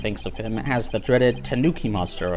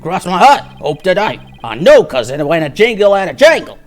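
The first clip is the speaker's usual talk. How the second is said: gruff witness voice; 4 semitones higher, 7 dB louder than usual. Acted. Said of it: "I know what voice to give him".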